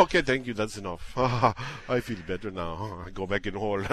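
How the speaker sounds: low, soft, spent murmur; mock afterglow. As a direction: wind down as if comically satisfied. Winding down after mock pleasure.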